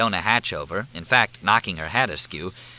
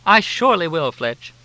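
very high, loud effusive greeting; gushing hostess; 9 semitones higher, 6 dB louder than usual. A narrator's reading. Helena greeting the group with high gushing warmth.

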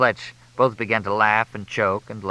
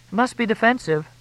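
female voice. A narrator's reading. Crystal's high voice.

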